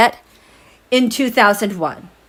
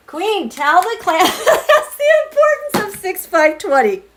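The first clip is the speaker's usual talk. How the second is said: laughing read-out; 9 semitones higher than usual. Real, not acted. Reading chat, laughing.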